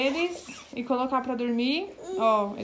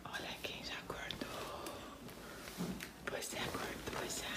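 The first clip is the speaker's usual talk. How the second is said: whisper; 19 dB quieter than usual. Real, not acted. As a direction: whisper so as not to wake the other. Baby just woke, mother whispers. (sussurrando baixinho)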